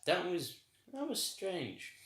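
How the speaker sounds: high, stunned "that was strange"; baffled exclaim. Real, not acted. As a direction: marvel at how weird it was. Baffled reaction to odd episode.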